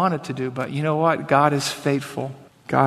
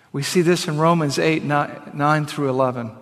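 ordinary talk

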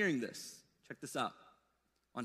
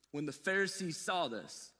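usual speaking voice

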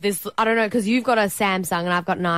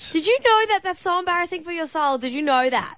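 mock shock; 10 semitones higher than usual. Mock horror at acronym.

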